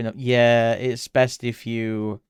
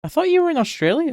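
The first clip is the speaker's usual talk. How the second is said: fast, much higher and louder surprise; genuinely surprised; 7 dB louder, faster than usual. Real, not acted. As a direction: blurt it out in real disbelief. Learns viewer isn't in Australia.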